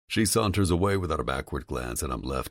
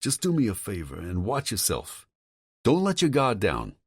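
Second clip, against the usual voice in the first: higher, agitated male voice on the phone; a worried friend pushing hard, voice raised in pitch; Tom's voice; 6 semitones higher than usual. Acted. Tom warning Vincent about his father, higher and agitated.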